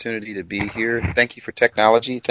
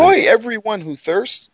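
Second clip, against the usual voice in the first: proclaiming call; 9 semitones higher, 4 dB louder, slower than usual. Retold or imitated. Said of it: "So he says".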